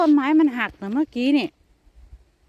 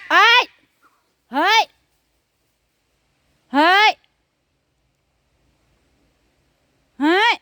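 high, loud yells at an intruder cat; loud startled yelling into the dark; alarmed shouting; 8 semitones higher, 6 dB louder than usual. Real, not acted. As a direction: shout to scare it off and call her cat.

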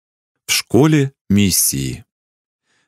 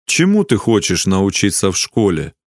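ordinary delivery

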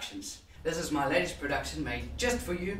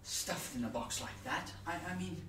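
softer, hesitant thinking aloud; musing monologue character; 2 semitones higher, 8 dB quieter than usual. Acted. Musing on being dead in a box, quietly uneasy. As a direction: quietly puzzle it out, with long pauses.

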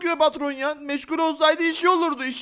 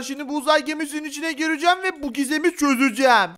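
ordinary talk, nothing marked

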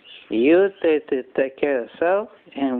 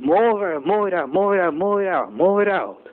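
mock yell; 6 semitones higher than usual. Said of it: "I go screaming".

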